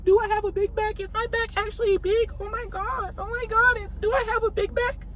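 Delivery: very high, mocking girly voice; a very high mock voice fretting into the mirror; mock insecure girl. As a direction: squeak it out like a panicked girl at the mirror. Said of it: "looking in the mirror".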